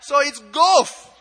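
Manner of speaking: put-on loud, high, slow Kenyan-accented GOFF; hammed-up Kenyan lady concluding. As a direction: say GOFF as one drawn-out syllable, shouted in a Kenyan accent. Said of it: "I'll do the accent".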